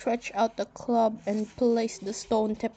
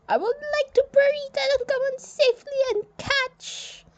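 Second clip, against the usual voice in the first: a much higher, firm general announcing the burial; stern general voice; 5 dB louder than usual. Acted. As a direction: speak as a proud Egyptian general, high and set.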